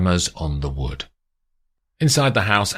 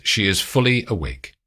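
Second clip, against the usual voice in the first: ordinary delivery, nothing marked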